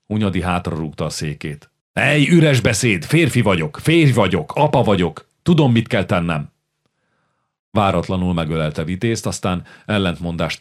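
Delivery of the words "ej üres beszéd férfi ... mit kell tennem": defiant outburst. Acted. Hunyadi kicks back chair, shouting.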